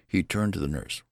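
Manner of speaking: ordinary delivery, nothing marked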